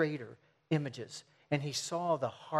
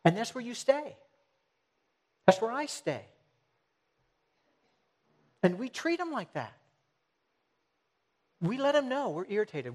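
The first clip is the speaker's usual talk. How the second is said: higher, louder preacher's build; preaching at full force; 6 semitones higher, 3 dB louder than usual. Acted. Preacher rising in force to convict his listeners. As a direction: press the point home from the pulpit.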